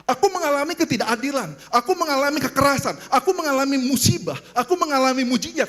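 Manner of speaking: high, impassioned preacher's voice; preaching. Real, not acted. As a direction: press it urgently on the congregation. Preaches prophet's complaint in refrain. (khotbah berapi-api dengan nada tinggi)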